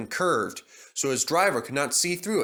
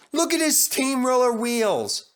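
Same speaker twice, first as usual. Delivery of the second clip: higher mocking jeer; teasing engines; 10 semitones higher, 3 dB louder than usual. A narrator's reading. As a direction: jeer at his odd wheels. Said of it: "they said".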